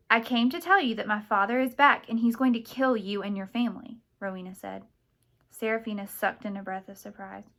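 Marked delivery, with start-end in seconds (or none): 0.1-4.0 loud, emphatic outburst; urgent confession. A narrator's reading. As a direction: blurt out the warning forcefully. Forced confession at knifepoint.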